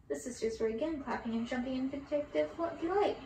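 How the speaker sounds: plain reading voice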